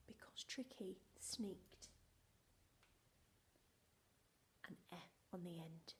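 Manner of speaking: whispered, conspiratorial; secretive whisper. Shown as a demonstration. Teacher whispering how Tricky hid the E. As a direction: let the class in on a secret in a whisper.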